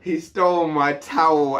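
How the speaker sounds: indignant outburst. Retold or imitated. Outraged retelling continues.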